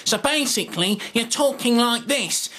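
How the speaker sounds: pitched high with force, a bad Moss impression; punchy Moss voice. Shown as a demonstration. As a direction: push power behind every word. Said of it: "add a bit more impact and punch".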